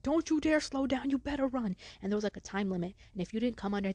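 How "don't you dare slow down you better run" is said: high, loud mock yell; barking coach. Coaches watching pupils run miles, yelling. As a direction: imitate a stern order shouted at runners.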